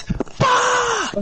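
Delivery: very high mock scream; imitated outburst. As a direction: yell it like a friend unmuting mid-rage. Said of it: "All we're just gonna hear is".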